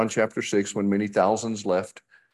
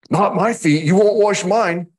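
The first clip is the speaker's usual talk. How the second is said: high, loud protesting Peter; 11 semitones higher, 7 dB louder than usual. Acted. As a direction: push back hard, high and loud. Peter protesting in retold scene.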